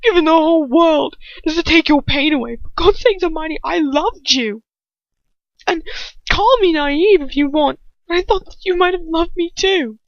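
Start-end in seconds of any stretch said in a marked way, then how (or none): 2.6-10.0 put-on high, pained plea from a hurt young man; pleading Ron. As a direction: beg her to see he loved her. Ron pleads his love to her.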